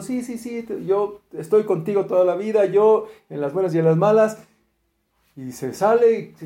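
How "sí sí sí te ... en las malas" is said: mock hollow promise. Traitor's hollow promise mocked.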